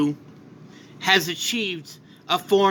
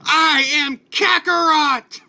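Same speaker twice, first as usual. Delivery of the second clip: much higher, louder, slow declaration; powered-up roar; 9 semitones higher, 4 dB louder, slower than usual. Acted. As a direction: bellow his true name as he powers up. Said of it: "He powers up and goes".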